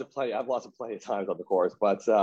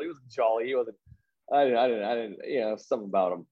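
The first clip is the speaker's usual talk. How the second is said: ordinary talk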